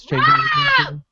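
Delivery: high shout from across the room; distant shout. Real, not acted. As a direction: yell it from far off. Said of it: "I can hear [name] shouting in the background".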